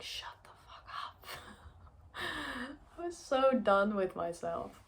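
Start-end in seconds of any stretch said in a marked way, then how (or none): 0.0-1.3 a soft, exasperated whispered retort; fed-up whisper. Retold or imitated. Telling her critical inner voice to stop, whispered. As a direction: hiss it under your breath, done with it.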